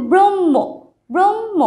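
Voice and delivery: ব্রহ্ম as "brommo", long firm m; ব্রহ্ম pronounced brom-mo. Shown as a demonstration. Teacher showing pupils how it sounds, ব্রহ্ম as brom-mo. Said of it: "উচ্চারণটা কী হবে".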